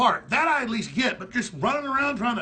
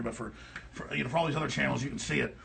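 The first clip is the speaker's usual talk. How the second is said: ordinary delivery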